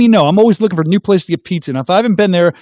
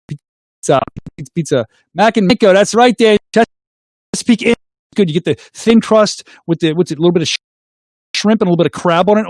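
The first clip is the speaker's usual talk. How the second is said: ordinary talk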